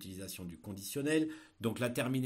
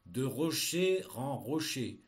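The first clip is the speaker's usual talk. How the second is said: dictating; 4 dB louder, slower than usual. Shown as a demonstration. Teacher dictating a text.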